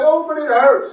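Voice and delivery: high, pleading whine of someone in pain; whining complaint. Retold or imitated. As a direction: whimper the protest. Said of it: "They say".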